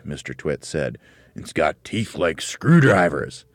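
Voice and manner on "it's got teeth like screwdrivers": slow, loud, drawn-out warning; ominous teasing. A narrator's reading. Mr. Twit describes the beast to frighten his wife, ominous teasing. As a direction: stretch it out menacingly.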